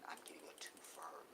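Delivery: unvoiced hushed aside, barely breathed; whisper. Real, not acted. Explaining his negotiating trick to viewers, in a whisper. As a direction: lean in and whisper the point.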